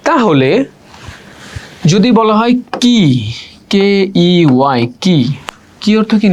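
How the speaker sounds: ordinary delivery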